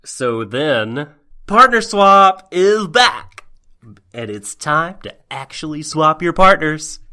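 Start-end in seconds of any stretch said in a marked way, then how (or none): 1.4-7.0 booming slow game show host. Pretend. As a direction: announce it like a TV host. Mock game show opening.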